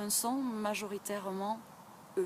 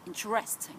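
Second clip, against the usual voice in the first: American accent. Shown as a demonstration. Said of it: "Ou chez les Américains".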